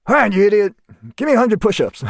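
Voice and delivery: high, exasperated officer's command; imitated commander's order. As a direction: play an officer dishing out punishment. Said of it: "He's like".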